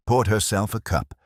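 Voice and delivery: plain reading voice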